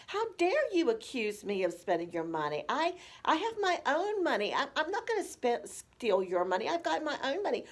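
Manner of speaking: mock offended retort, pitch jumping; indignant. Adult child answers parent's accusation of stealing, indignant. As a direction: protest the accusation with hurt pride.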